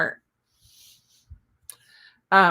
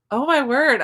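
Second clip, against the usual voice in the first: surprised exclamation; 6 semitones higher, 6 dB louder than usual. Real, not acted. Surprised at a chat comment.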